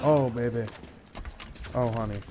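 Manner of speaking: ordinary talk, nothing marked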